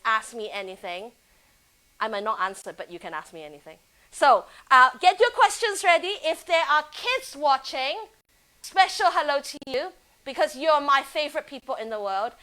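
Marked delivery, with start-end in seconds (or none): 4.1-12.3 higher, louder rallying voice; cheerful host energy; upbeat call. Real, not acted. Rallying viewers and kids.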